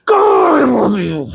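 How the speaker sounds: high, loud squealing gush of love. Acted. Dad squeezing his adorable little girl's cheeks, squealing with love.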